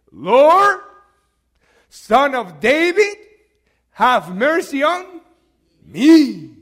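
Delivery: loud, drawn-out plea; crying out for mercy. Acted. Blind man calling to Jesus through the crowd, crying out for mercy. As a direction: call out slowly and loudly, begging for mercy. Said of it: "he cried out for mercy".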